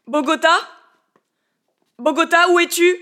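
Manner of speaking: loud, high call across the fields. Acted. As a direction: shout for him, voice rising. Il appelle Bogota dans les champs. (appel crié, aigu et fort)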